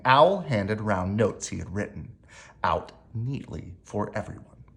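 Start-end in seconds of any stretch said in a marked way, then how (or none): none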